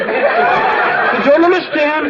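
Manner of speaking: high, slow drawn-out Dennis imitation; mock Dennis. [name] still parodying Dennis, high and slow. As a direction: stretch it out like a dim kid. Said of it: "I don't understand you".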